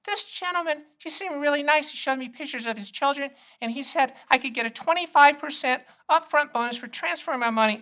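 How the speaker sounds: high, soft elderly mom impression. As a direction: play the old mom gushing about a salesman. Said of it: "she'll call me every time and go".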